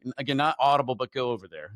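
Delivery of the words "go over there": low, soft inner voice; hushed nudge. Retold or imitated. God nudging him while he sits in the Jeep, soft and low. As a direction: murmur it like a quiet inner prompt.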